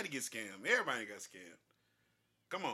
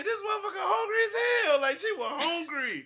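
very high, loud outraged yell; shouting; 14 semitones higher, 7 dB louder than usual. Retold or imitated. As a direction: yell it like you're losing it. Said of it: "I said".

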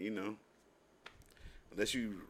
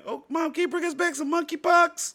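put-on much higher, squeaky child begging mom; excited kid voice; 14 semitones higher, 4 dB louder than usual. Kid asking Mom for monkeypox cereal, high and eager. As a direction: beg like a kid at the grocery store.

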